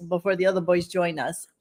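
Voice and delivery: ordinary talk, nothing marked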